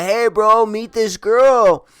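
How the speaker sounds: loud, high, drawn-out hail to a buddy; retold upbeat shout, last words stretched; eager introduction. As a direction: call your pal over to meet someone. Introduces her to his friend.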